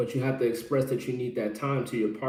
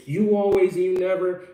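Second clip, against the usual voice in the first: higher, louder angry accusing; angry accusation; 5 semitones higher, 5 dB louder than usual. Retold or imitated. Blaming partner in anger, angry accusing voice. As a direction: lash out at your partner in blame. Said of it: "be angry and then respond and say".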